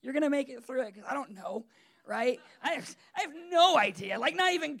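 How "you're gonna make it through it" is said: soft, hushed comfort for his pregnant wife; mock tender comfort. Husband soothing his pregnant wife with swollen feet, soft and tender. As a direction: coo it gently like a husband who has no idea. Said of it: "so I'm just like".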